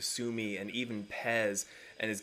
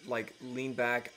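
ordinary talk

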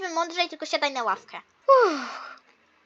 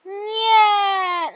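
mock crying; 5 semitones higher, 5 dB louder than usual. Groaning at the plank test.

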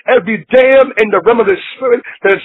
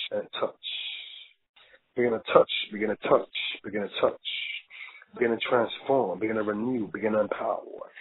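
much softer, lower, near-whispered prayer; 11 semitones lower, 15 dB quieter than usual. Acted. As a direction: breathe the words as a hushed plea. Whispered prayer during ministry.

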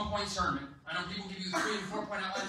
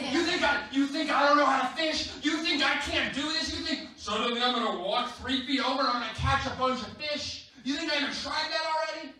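high, loud incredulous tirade; acted outrage, voice raised throughout; indignant rant; 6 semitones higher, 4 dB louder than usual. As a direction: play an insulted expert firing back. Imagined Peter rants at stranger.

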